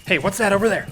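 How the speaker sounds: high, loud alert; alarmed call. Acted. The general spotting strangers, calling out in alarm. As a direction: call out the sighting.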